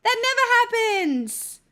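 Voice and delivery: high, slow, smug self-praise; mock cool influencer. Streamer bragging to chat, slow and smug. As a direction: drawl it out like a show-off. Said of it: "I'm so cool".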